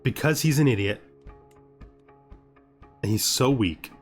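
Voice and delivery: ordinary talk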